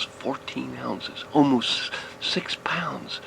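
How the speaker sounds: drawn-out, slow weight announcement; slow reveal. A narrator's reading. Storyteller reveals how heavy the bass was, slow and savouring. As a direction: savour each number.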